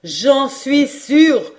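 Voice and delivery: loud, high, very slow declaration; insist, drawing out every word; emphatic certainty. Acted. Geographer answering disbelief, emphatically certain.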